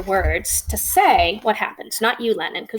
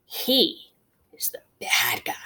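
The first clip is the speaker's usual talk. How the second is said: whisper. Real, not acted. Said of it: "whispered villain label".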